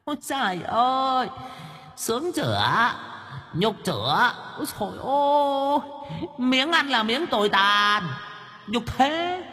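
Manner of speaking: very high witch voice, mocking and gleeful; cackling witch. A narrator's reading. Ghost hag in the treetop gloats over the old man, cackling high. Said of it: "nó cười khanh khách".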